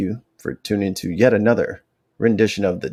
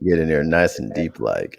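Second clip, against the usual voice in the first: mock-seductive deep voice; 7 semitones lower than usual. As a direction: push the voice down low. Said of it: "Is that the deepest your voice can go?".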